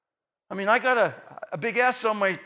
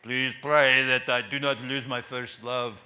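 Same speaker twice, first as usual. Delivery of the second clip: slow, weighty imitation of a preacher; preacher's slow sign-off, lightly imitated; imitating admired preacher; 8 semitones lower, slower than usual. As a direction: lightly copy a preacher's measured closing plea. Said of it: "to be just like him".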